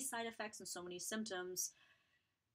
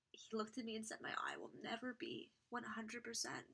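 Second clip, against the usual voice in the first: quiet, near-whispered, holding back tears; softly recalling painful news; emotional; 3 semitones higher, 5 dB quieter than usual. Real, not acted. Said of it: "I did not wanna get emotional".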